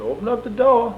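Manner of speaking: high, loud mock order; imitating Sheriff Tyson. Sheriff orders the cell door opened, high loud imitation. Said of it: "He said".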